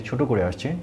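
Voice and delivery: usual speaking voice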